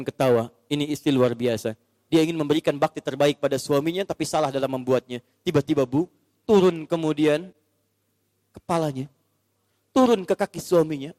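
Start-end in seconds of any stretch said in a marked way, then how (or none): none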